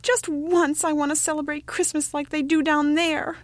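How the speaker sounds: put-on high, wishful child's plea; youngest child crying out. A narrator's reading. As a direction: beg mother like a little boy. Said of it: "he cried".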